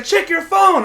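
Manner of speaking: high, loud urgent command; urgent order. Retold or imitated. Girlfriend telling him to check, loud and high. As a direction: snap it out urgently. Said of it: "She's like".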